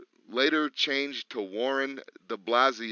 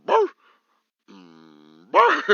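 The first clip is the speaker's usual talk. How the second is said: dog bark imitation; 6 semitones lower, 12 dB louder than usual. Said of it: "He just keeps going".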